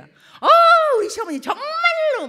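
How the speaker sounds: a daughter-in-law venting about her mother-in-law; shrieked complaint, pitch way up; exasperated daughter-in-law. Retold or imitated.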